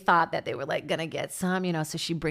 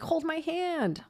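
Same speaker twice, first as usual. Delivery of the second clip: clingy whine; 9 semitones higher, 4 dB quieter, much slower than usual. Retold or imitated. Clingy girlfriend voice.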